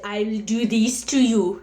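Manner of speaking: a lightly done mock German-Russian strongman accent; a mild parody of a tough accented woman; Schwarzenegger voice. Mocking guys who imitate Russian or German women, with a light strongman accent. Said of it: "a slightly falsetto Schwarzenegger kind of voice".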